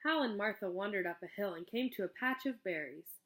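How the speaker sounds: ordinary delivery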